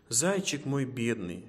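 ordinary delivery, nothing marked

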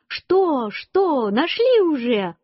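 high, eager questioning without much volume; excited cry. A narrator's reading. Mother jumping up at the mushroom find, high excited cry. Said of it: "Мама вскочила и кричит".

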